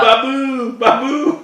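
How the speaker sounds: high, loud sitcom catchphrase; Indian man. Acted. Quoting a remembered Seinfeld line, high mock Indian man. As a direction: call out the name in a high put-on voice. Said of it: "the Indian man".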